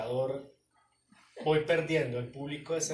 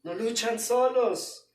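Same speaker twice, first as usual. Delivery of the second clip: reassuring; 7 semitones higher, 5 dB louder than usual. Acted. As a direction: lift them gently. Pastor reassures slowly.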